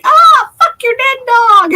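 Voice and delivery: loud, high, drawn-out mock screaming; high-pitched yelled impression of a screamer. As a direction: imitate someone screaming at the top of their voice. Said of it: "they're screaming like".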